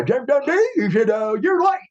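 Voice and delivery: mock high scolding boss voice; Jim Ross. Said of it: "old Jim Ross, he says".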